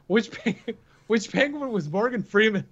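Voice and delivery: reading a joke review and cracking up mid-word; laughing while reading. Real, not acted. Host reading a one-star review aloud, laughing through it.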